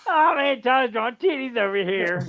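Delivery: high-pitched imitation of a yelling classmate; mock yell. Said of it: "yells out loud".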